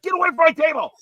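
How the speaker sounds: very high, loud mock scream. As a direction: imitate a furious man screaming at an intruder. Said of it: "he's screaming at me".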